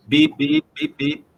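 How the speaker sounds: high beeping like a censor bleep. Pretend. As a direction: beep over the swearing in a high voice. Bleeping out his own swearing.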